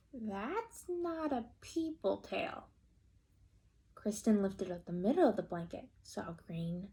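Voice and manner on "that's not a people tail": slow, a touch puzzled; doubtful remark. Acted. Kristen peeking at the tail, slow and doubtful. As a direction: say it slowly, unsure. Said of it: "and said".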